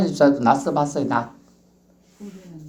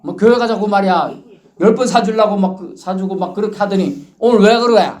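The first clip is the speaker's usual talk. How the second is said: louder mock irritation; annoyed complaint; 5 semitones higher, 4 dB louder than usual. Friend scolding the evangelist who ran out of money, mock annoyed. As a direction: grumble like a let-down friend. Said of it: "막 화내고 가더라는 거지".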